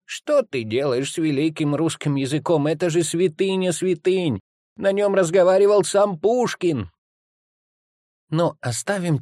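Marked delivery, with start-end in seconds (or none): 0.1-6.9 indignant aunt. Acted. Aunt scolding the boy over his Russian, indignant and high-pitched. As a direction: make a high, theatrical fuss as the aunt. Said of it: "вот бы подняла шум".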